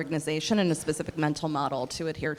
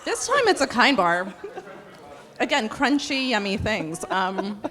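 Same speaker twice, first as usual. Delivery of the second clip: laughing; 4 semitones higher than usual. Real, not acted. Laughing while chewing snack.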